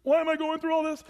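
a hammed-up self-pitying whine; mock whining. Preacher mocks a congregation whining about suffering, high whine. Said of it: "sitting around and whining".

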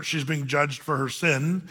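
ordinary talk, nothing marked

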